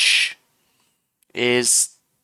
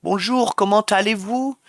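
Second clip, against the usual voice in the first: higher, lilting France French; French-from-France accent; 6 semitones higher than usual. Shown as a demonstration. Streamer shows chat how France says hello, French accent. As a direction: do French as in France, pitched high. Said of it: "I can't fully do the French accent".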